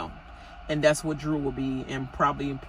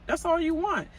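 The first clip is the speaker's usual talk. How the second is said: normal talking voice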